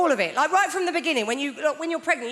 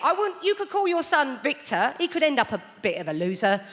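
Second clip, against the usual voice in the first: ordinary talk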